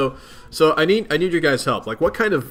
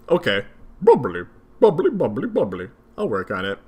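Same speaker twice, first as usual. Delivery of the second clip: ordinary talk, nothing marked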